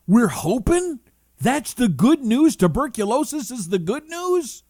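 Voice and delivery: high, loud disbelieving repeat; incredulous disbelief. Retold or imitated. Patient echoing doctor, high incredulous disbelief. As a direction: echo the doctor in shock.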